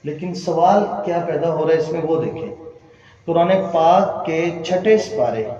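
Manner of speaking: ordinary talk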